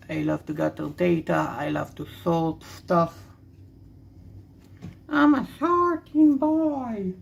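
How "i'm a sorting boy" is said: high, drawn-out playful cry; playful boast. Pretend. Vlogger playfully boasting of his love of sorting, high and drawn out. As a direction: stretch it out high and silly.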